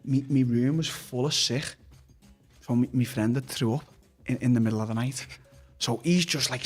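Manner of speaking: normal talking voice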